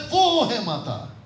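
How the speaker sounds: long, falling वो before है माता; stress on वो. Shown as a demonstration. Teacher voicing the writer's intent, long stressed वो. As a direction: stretch वो as if pointing her out. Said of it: "वो कहता है".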